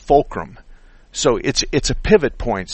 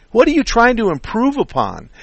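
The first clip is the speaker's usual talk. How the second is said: pitched way up and louder, incredulous; exasperated; 11 semitones higher, 4 dB louder than usual. Real, not acted. Veteran mechanic exasperated by the shop's upsell, high and loud. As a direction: fling the question up high in disbelief.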